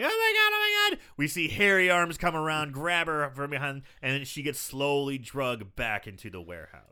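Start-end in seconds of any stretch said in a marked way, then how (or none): 0.0-1.0 high-pitched imitated panic, only slightly louder; mock scream. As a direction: play a screaming victim in a squeaky high voice. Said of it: "she's screaming, going".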